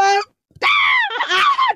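piercing high scream; real panicked scream; terrified scream. Real, not acted. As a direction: shriek at the jumpscare. Chased, door won't open.

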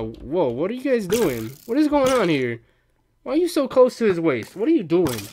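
loud, high startled yelling; shocked shout. Real, not acted. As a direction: yell in sudden disbelief. Shocked at mobs in game.

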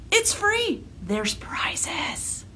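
secret whispered aside. Acted.

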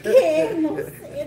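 flustered laughing denial. Retold or imitated. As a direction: caught red-handed, laugh it off. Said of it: "Y hago yo". (caught sitting on the cards, flustered laughing denial)

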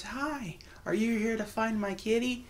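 put-on high, soft little girl voice. GM voicing a little girl asking the party about her kitty. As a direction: squeak it out like a small shy child. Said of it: "she's got a little voice and goes".

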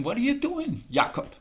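put-on high, bright girl asking gently; a lightly voiced young girl's question; Janie's voice. Janie asks Jakob what he is doing, voiced high. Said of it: "And she asked him".

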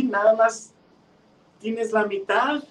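high, belittling storybook pot; taunting. A narrator's reading. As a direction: rub it in, high and drawn out. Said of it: "lastimaba mucho a la otra y le decía".

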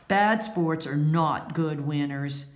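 plain reading voice

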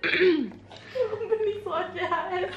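laughing outburst. Real, not acted. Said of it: "Warum hast du gerade gesagt".

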